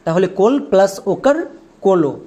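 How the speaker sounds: ordinary delivery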